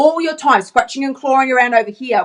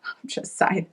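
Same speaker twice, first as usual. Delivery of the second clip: breathy laughing whisper; a whispered little joke aside; 3 semitones lower, 12 dB quieter, slower than usual. Real, not acted. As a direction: toss it off under your breath.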